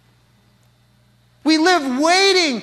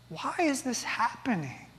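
much softer, almost to himself; hushed, broken question; 3 semitones lower, 12 dB quieter than usual. Real, not acted. Preacher asking congregation the same question, hushed and heavy. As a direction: let the question sink low and heavy.

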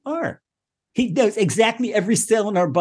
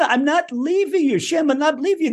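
stubborn pleading; 6 semitones higher than usual. Acted. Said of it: "you're stubborn about it".

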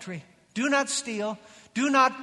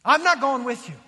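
louder, emphatic refusal; firm, raised-voice refusal; ticked off God; 2 semitones higher, 6 dB louder than usual. Retold or imitated. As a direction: put your foot down, imitating an angry God. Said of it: "a very ticked off God".

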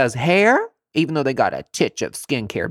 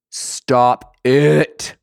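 loud stop it; 7 dB louder than usual. Real, not acted. Loud repeat.

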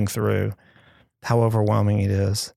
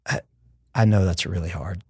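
gentle comfort; 5 semitones lower than usual. Retold or imitated. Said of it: "I wish he would just said".

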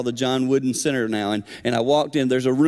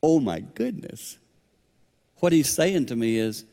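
ordinary delivery

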